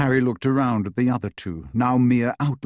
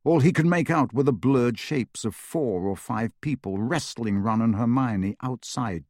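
plain reading voice

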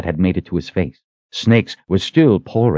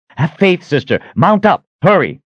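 high, pressing commands; urgent exhortation; 11 semitones higher, 4 dB louder than usual. A narrator's reading. Richard urging the Sister to have faith, urgent. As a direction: urge her on in raised, punchy phrases. Richard urges her to mount.

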